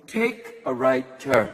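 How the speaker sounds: slow, flat machine command; robotic GPS. Retold or imitated. GPS on the Golden Gate Bridge, slow robotic. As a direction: sound like a calm computer. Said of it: "the car went".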